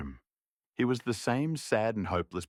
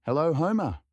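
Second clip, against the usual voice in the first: cheery greeting; 8 semitones higher, 4 dB louder than usual. Acted. Cook greets philosopher loudly.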